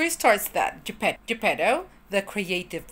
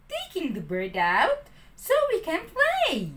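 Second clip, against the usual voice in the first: a hint of a carefree boy; cheerful puppet; 8 semitones higher than usual. Pinocchio answering Jiminy, lightly voiced. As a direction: brush off the worry lightly. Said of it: "the puppet replied".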